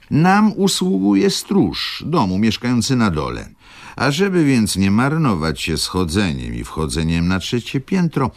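high, honeyed mock politeness; lightly voiced sugary lodger; sweet tone. A narrator's reading. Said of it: "Zakończył tak słodkim tonem".

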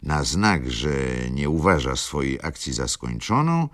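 plain reading voice

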